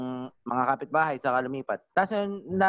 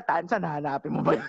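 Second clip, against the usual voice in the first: ordinary talk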